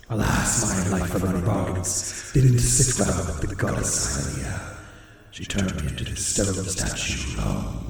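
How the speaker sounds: very low, slow raspy growl; ominous demon statue. Acted. Demon statue to the one who hears it, ominous. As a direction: speak as an ancient, breathy demon.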